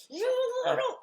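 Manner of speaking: mock Homer throttling Bart, high and loud; Homer strangling Bart. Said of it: "giving Homer and Bart".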